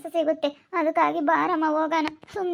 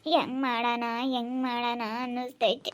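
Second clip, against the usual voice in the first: low, hushed, slow fretting; worried brooding; 3 semitones lower, 6 dB quieter than usual. Acted. Fretting alone over the group loan payments, low and subdued. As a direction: think aloud softly, weighed down by worry.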